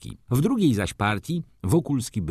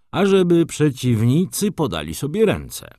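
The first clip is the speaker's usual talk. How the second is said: high, loud ceremonious announcement; solemn proclamation; 8 semitones higher, 5 dB louder than usual. A narrator's reading. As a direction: proclaim it formally to both duellists. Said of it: "rzekł uroczyście hrabia Anglik". (uroczyste, donośne wezwanie)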